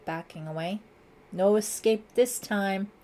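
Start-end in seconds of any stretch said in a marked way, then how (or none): 1.3-2.9 slow, louder pronouncement; declared threat. A narrator's reading. Lieutenant aims at suspect, slow loud threat. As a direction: deliver the threat slowly and firmly. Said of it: "Lieutenant Wilkins declared".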